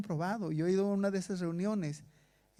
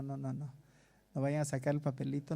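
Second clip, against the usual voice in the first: ordinary talk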